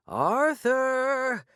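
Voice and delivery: a high, long-held cry of Arthur; crying out a name. Retold or imitated. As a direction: call out to a lost husband. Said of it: "I heard her crying".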